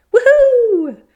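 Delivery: very high, loud joyous whoop. A narrator's reading. The boar whooping with joy at the sky, loud and high. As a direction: let out a wild cheer.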